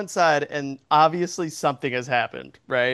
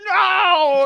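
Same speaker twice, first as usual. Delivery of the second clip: loud high outburst; shouting no; 11 semitones higher, 7 dB louder than usual. Retold or imitated. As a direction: yell it, refusing hard. Uncle Jerry refuses, starts strangling.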